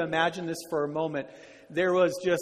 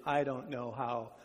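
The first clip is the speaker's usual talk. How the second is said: quiet, low voice of despair; understated desperation, softly sinking; desperate; 5 semitones lower, 9 dB quieter than usual. Acted. Corey confides despair to her sister in camp, quietly desperate. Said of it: "cried out to her sister in desperation".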